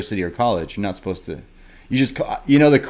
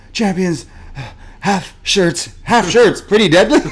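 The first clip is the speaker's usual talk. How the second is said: gasped out between breaths, higher; panting; 6 semitones higher, 4 dB louder than usual. Retold or imitated. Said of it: "catching his breath".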